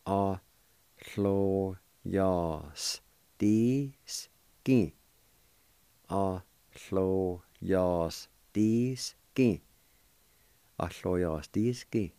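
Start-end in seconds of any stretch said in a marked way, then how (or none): 0.1-4.9 "lló yas dís gin" stretched out to a crawl; very slow model. Shown as a demonstration. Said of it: "And it's pronounced like this". 6.1-9.6 "lló yas dís gin" stretched out to a crawl; very slow model. Shown as a demonstration. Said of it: "And it's pronounced like this".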